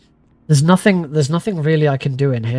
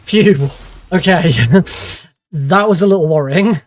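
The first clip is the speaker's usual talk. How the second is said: louder relieved sigh and nervous laugh; 2 semitones higher, 4 dB louder than usual. Real, not acted. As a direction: let the tension out with a laugh. Relief after narrowly avoiding detection.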